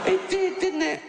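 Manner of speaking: very high, disbelieving retort; incredulous squeak. Real, not acted. Comic answering the tweeter's absurd claim, very high. Punchline reply about Holocaust.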